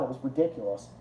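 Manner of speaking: ordinary delivery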